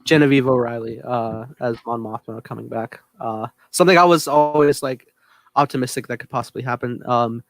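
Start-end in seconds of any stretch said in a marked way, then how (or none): none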